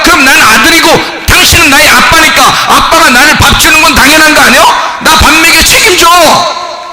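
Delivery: high, loud preaching build; fiery preaching. Acted. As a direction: preach it hard at the congregation. Preaching at full force.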